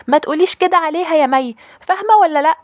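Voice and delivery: put-on loud, very high child's yell; shouting little sister. Little sister shouts at her older sister defending the maid, high loud yell. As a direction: scold loudly in a high little-girl voice. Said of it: "بتزعقلي بصوت عالي".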